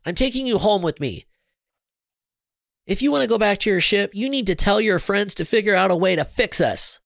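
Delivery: high-pitched, insistent ultimatum; demanding. A narrator's reading. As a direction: lay down terms to your captive. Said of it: "she demanded".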